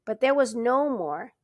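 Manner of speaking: usual speaking voice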